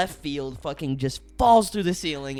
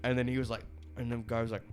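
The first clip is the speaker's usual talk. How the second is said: normal talking voice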